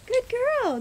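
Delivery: high, bright praise; dog praise voice. Real, not acted. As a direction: gush over a good dog. Praising dog after command.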